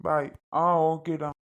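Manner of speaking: normal talking voice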